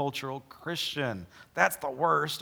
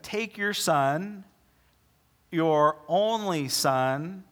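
slow, drawn-out reading; 2 semitones higher, 3 dB louder, much slower than usual. Acted. Preacher reads God's command slowly.

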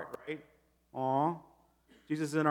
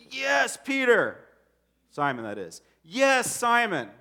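high, loud and slow, bursting with joy; joyful high-pitched yes; excited; 7 semitones higher, 5 dB louder, slower than usual. Retold or imitated. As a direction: voice a thrilled Jesus, beaming. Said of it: "I think he got excited".

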